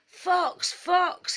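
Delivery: false alarm yelled from under a bush. Acted. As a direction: shout it so the whole picnic hears. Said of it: "he yelled".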